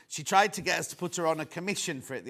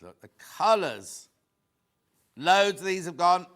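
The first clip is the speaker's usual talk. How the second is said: normal talking voice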